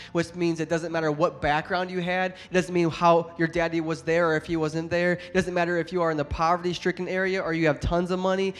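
ordinary talk, nothing marked

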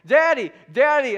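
loud, high imitation of a pestering child; nagging kid shout for attention; little kid calling Daddy. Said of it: "She's like".

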